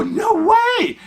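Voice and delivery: very high, drawn-up disbelief; incredulous. Retold or imitated. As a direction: squeal it in amazed disbelief. Refuses to believe husband.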